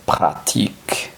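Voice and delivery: "pratique" with no k sound before the t; "pratique" softly, ending in -ique; pronounced without C. Shown as a demonstration. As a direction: model French "pratique", dropping the c of práctico. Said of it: "Práctico sería sin la C".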